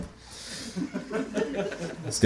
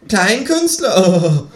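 very slow, high, louder kangaroo; 12 semitones higher, 6 dB louder, much slower than usual. Acted. The kangaroo rubs the nasty word in, high and slow. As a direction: drag the word out, high and loud.

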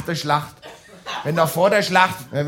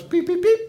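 imitated beeping; 13 semitones higher than usual. Card reader beeping.

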